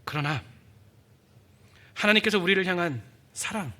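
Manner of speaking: usual speaking voice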